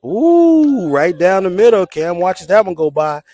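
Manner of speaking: loud, high drawn-out ooh, then a punchy call; real excitement at the pitch, voice leaping up; excited play-by-play. Real, not acted. Announcer calling a pitch down the middle, excited.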